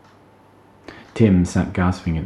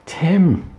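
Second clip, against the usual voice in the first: much higher cry with a wobble; wobbling cry; 12 semitones higher, 4 dB louder than usual. Acted. Emma calling Tim into the dark forest, voice wobbling. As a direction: call out, voice wobbling with fear. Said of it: "Emma cried into the forest, a wobble in her voice".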